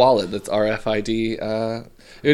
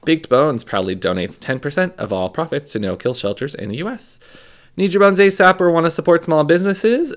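ad read; 4 dB louder than usual. Acted. Reads a sponsor ad.

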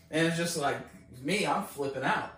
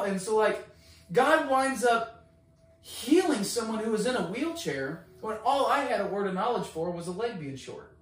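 usual speaking voice

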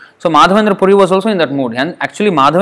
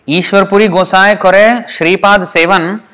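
slow, higher chanted reading of the verse; Bengali verse recitation; 3 semitones higher, much slower than usual. Shown as a demonstration. Teacher recites the Bengali verse to the listeners, slow chant. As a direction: intone each word of the scripture slowly. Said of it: "you see what the verse is".

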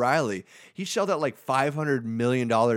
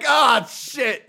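mock shout; 11 dB louder than usual. Native Americans' mock reaction.

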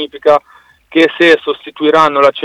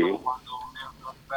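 faint, distant phone voice; speakerphone; 19 dB quieter, faster than usual. Real, not acted. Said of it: "Sei in viva voce". (in vivavoce, lontano e debole)

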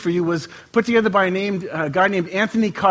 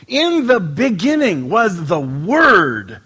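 slow, louder solemn reading; scripture reading; 4 dB louder, much slower than usual. Acted. Pastor reading John to the church, slow solemn scripture. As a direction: read it out like holy writ, slowly.

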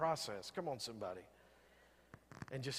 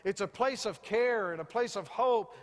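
higher, louder preaching; 5 semitones higher, 4 dB louder than usual. Acted. Pastor rousing the congregation about joy, fervent preaching. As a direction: lift the congregation with rising fervour. Preaching builds to full force.

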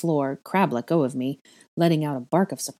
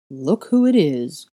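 loud, slow taunt with a grunted edge; slow bully's jeer, pushed loud; grunting bully; 6 dB louder, slower than usual. A narrator's reading. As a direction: draw it out loudly like a bully. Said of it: "Goyle grunted".

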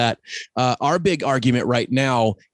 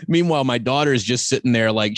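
ordinary talk, nothing marked

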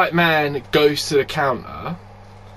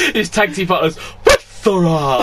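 loud ring announcer calling a wrestler's name; mock wrestling introduction; 3 semitones higher, 3 dB louder than usual. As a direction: hype the name like an arena MC.

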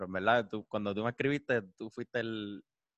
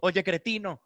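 insulto actuado; 7 semitones higher, slower than usual. Acted. Acted insult, correcting the quote.

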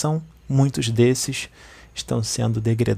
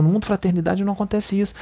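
ordinary talk

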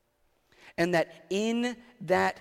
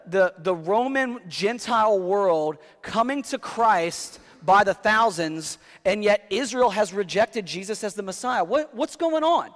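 loud, higher, pressing pulpit voice; preaching at full force; 5 semitones higher, 7 dB louder than usual. Acted. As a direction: build the question till it peaks. Building the sermon's question.

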